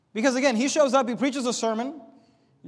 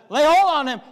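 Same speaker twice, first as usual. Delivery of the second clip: loud, raised angry command; mad king; 4 semitones higher, 7 dB louder than usual. Retold or imitated. Jeroboam mad at the sermon, commanding the prophet's arrest, angry shout. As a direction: fling the order out in a rage. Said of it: "Jeroboam gets mad".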